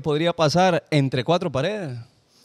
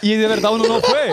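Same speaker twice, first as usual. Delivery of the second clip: entre risas; 5 semitones higher, 4 dB louder than usual. Real, not acted. Se ríe al negar el gas.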